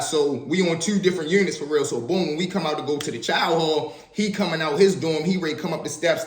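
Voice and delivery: ordinary talk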